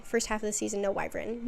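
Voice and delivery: ordinary talk, nothing marked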